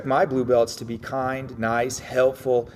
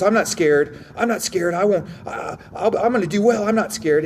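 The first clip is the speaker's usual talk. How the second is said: higher-pitched, stammering insistence; mock nervous denial; 7 semitones higher than usual. Acting out the guy who won't admit fear, in nervous denial. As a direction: imitate a rattled guy protesting too much. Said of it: "it's the guy that goes".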